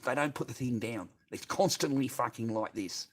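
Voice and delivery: normal talking voice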